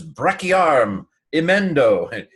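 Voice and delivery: high, loud mock incantation; wizard casting spell. Teacher quoting a Harry Potter spell to students, mock incantation. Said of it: "he does a spell to try to fix the arm".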